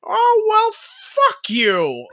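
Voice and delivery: high, startled folksy swear; Jimmy Stewart impression. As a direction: say it like an aw-shucks hero cursing. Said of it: "Jimmy Stewart saying".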